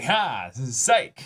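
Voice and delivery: loud, high gotcha; a loud, mocking psych; mock taunt. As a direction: taunt the audience with a trick. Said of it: "to be like".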